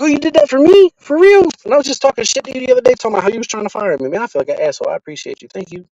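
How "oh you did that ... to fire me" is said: high, loud, amazed disbelief; surprised gratitude. Retold or imitated. Told the boss saved his job, high astonished thanks.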